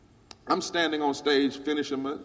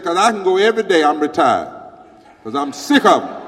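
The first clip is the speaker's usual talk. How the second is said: much louder, fast then emphatic; loud imitation of a fed-up retiree; loud declaration; 3 semitones higher, 13 dB louder than usual. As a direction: shout it to the crowd. Lady's retold speech.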